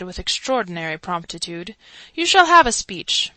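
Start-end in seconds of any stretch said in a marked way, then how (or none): 2.1-3.3 louder, higher proclamation; louder, higher public promise; grand announcement. A narrator's reading. Chancellor answers crowd promptly.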